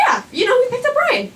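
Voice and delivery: high-pitched, would-be casual admission; awkward admission. Retold or imitated. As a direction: voice climbs high as you play it down. Awkwardly admitting it to mom.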